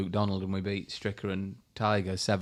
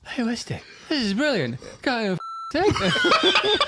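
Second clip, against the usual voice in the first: high-pitched, hammed-up delighted outburst; mock-excited caddie, voice way up high; gleeful caddie shout; 7 dB louder than usual. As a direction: call out gleefully as if ears are plugged. Caddie clowning with ears plugged.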